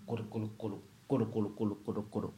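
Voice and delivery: quick, low, soft pigeon coos; pigeon cooing. Retold or imitated. Preacher shows how pigeons sound, soft rapid cooing. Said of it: "那个鸽，鸽子一种".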